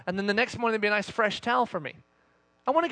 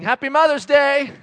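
loud, higher-pitched parting shout; dad hollering goodbye; cheery call; 5 semitones higher, 7 dB louder than usual. Acted. Dad calls while walking out door.

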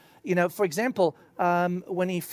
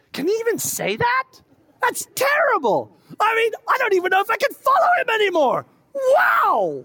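much higher, louder, scandalized rant; outraged disciple; 7 dB louder than usual. Retold or imitated. Disciple storming off from Jesus' teaching, high indignant outrage. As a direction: sputter in disbelief at what he said.

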